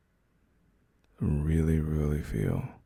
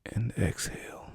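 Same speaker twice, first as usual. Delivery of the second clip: soft whisper, letting the breath out; whispered exhale cue; 3 semitones higher, 7 dB quieter than usual. Acted. Calming a panicking partner, whispered breathing cue. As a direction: breathe the word out gently in a whisper.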